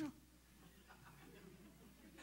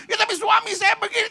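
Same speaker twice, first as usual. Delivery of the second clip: a higher, louder protest; mock angry complaint; 9 semitones higher, 7 dB louder, faster than usual. Preacher imitates an angry wife blaming her husband, loud protest. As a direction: snap it like an annoyed wife. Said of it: "terus marah dan bilang".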